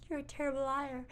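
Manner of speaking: high, feeble mock patient voice; dying First Lady. Dying First Lady answers her husband, high feeble voice. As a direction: say it high and faint from a sickbed. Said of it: "And she's like".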